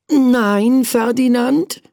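high little prince urging himself on; prince talking to himself. Acted. Tired prince to himself, high self-admonishing voice. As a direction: tell yourself off in a high child voice. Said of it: "sagte er zu sich".

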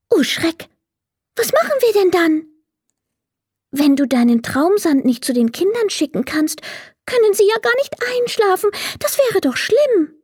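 very high, worried little fairy voice; dismayed little fairy. A narrator's reading. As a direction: squeak it out in high-pitched dismay. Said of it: "merkte die kleine Fee traurig an".

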